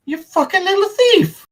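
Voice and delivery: very high-pitched indignation; outraged Coulson. Acted. Man accusing the one reaching into his pocket, outraged. NPC catches hand in pocket.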